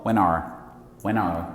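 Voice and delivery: "when are" linked as "whe-nare", low; joined correctly. Shown as a demonstration. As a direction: let the n of "when" start "are". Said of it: "quando você junta aqui, você fala corretamente".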